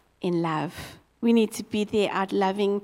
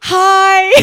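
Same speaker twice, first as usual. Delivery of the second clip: higher and louder, caught off guard; startled into a too-bright hi; playful awkward panic; flustered nervous; 7 semitones higher, 12 dB louder, slower than usual. Retold or imitated. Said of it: "I'm like".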